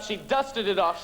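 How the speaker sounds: ordinary talk, nothing marked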